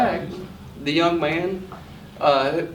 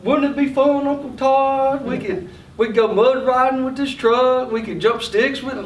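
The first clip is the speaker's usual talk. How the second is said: put-on coaxing little boy; 7 semitones higher than usual. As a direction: sweet-talk your uncle into a toy. Nephew angling for a toy.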